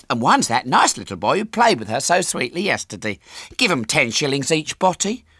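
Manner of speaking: gush, then sweetly command; gushing mother. A narrator's reading. Mother telling husband to reward the boys, gushing.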